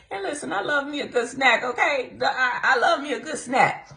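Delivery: high laughing through the words. Real, not acted. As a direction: laugh while admitting it. Laughing about loving snacks.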